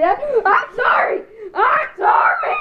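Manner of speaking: high, loud pleading yell; shouted sorry. Pretend. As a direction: yell the apology, pitch shooting up. Apologizing loudly after attack.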